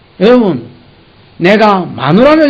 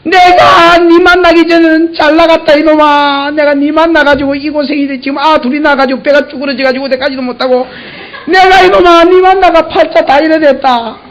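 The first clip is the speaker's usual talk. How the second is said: mock angry wife; 6 semitones higher than usual. Wife yelling at her husband, mock angry shout. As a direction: scold him like a fed-up wife. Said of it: "불만사항이 있으면".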